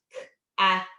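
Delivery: "K" and "at" said as separate sounds; segmented sounds. Shown as a demonstration. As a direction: break "cat" into "K" then "at". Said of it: "break it apart into its component sounds".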